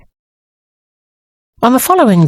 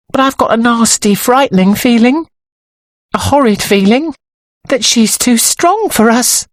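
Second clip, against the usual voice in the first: high-pitched, uneasy character voice; high voice, dwelling on the horror; anxious dressmaker's assistant, uneasy; 10 semitones higher than usual. A narrator's reading. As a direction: confide a dread in a high voice.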